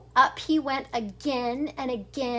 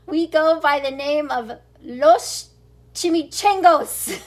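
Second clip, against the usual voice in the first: 1 mock growl; 2 semitones higher, 7 dB louder than usual. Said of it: "growled Don Diego".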